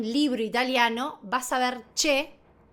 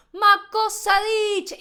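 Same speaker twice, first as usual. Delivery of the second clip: loud, high Italian exclamation; like one more Italian, louder and higher; Italian accent; 7 semitones higher, 6 dB louder than usual. Acted. Teacher slipping into street Italian, loud Italian accent. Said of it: "ya estoy como una italiana más".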